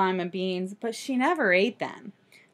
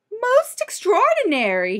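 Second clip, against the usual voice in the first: high, astonished storybook doctor; pitched-up amazement; amazed exclamation; 10 semitones higher, 4 dB louder than usual. Acted. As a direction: exclaim it in wonder. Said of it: "he ex-, exclaimed".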